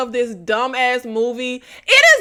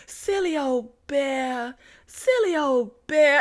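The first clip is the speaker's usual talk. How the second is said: gentle quoted line; 6 dB quieter, slower than usual. Retold or imitated. Quoting Christopher's line.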